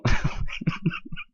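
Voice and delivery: high, breathy giggling fit; real helpless laughter. Real, not acted. Host asking for subscribers, breaking into laughter. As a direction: crack up right after the plug. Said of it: "I'm out of breath from laughing".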